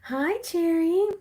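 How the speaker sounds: high, drawn-out singsong greeting; slow, squealy mock hello; Patricia. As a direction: put on a loud, high-pitched pretend voice. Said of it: "I'm gonna do Patricia's voice".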